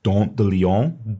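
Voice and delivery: dent de lion said slowly in a French accent; French pronunciation. Shown as a demonstration. Teacher showing students the French origin, in a French accent. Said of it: "Dandelion comes from French".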